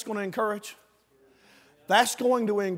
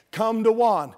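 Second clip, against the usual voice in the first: usual speaking voice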